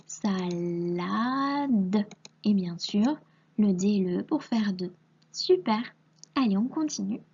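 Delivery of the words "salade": "salade" very drawn out, sa-laa-de, each sound held; stretched syllables. Shown as a demonstration. Teacher dictating for a pupil to write, stretched syllables. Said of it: "on entend la syllabe".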